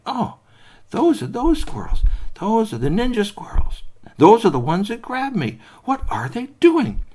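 startled little toy exclaiming; alarmed recognition. Acted. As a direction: realise with alarm who they are. Squeaky recognises squirrels.

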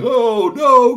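high, loud comic wailing. Acted. Tony and Kashi wailing over their crashed truck, loud high. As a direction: wail over the wrecked truck, rising to a shout. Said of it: "sitting there wailing".

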